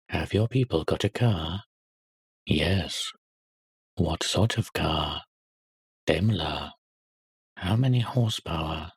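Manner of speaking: a hint of a grilling between boys; schoolboy quiz. Boys quizzing the little boy.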